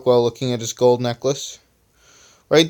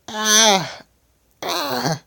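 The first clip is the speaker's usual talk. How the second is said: two short high-pitched acted cries; high yelp of pain; 10 semitones higher than usual. A narrator's reading. Flyer's hair regrows painfully, high acted yelp. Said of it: "suddenly getting a shock of pain".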